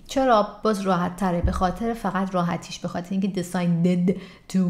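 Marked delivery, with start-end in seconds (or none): none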